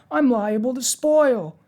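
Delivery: slow, high, loud protest. A narrator's reading. Pumpkinhead afraid he will spoil, protesting. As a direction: wail it slowly, pitched right up. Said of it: "protested Jack".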